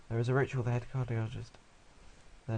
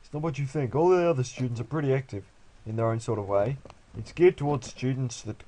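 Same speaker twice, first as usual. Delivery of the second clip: louder put-on Australian accent; 5 dB louder than usual. Parent selling the new school, loud mock Australian accent. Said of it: "people in Japan sound Australian".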